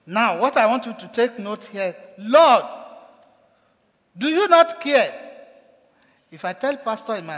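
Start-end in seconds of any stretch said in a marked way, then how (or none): 2.2-5.1 loud, high, reproachful Lord; accusing cry. Retold or imitated. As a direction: hurl it at him like an accusation. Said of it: "It's an accusation".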